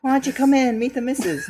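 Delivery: high, squeaky little worm voice. Retold or imitated. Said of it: "He's like".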